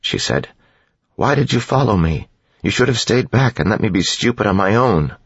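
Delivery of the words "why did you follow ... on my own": put-on higher, remorseful young voice; young woman. A narrator's reading. Vin apologizes to Sazed for his capture, higher and remorseful. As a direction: blame yourself, pleading.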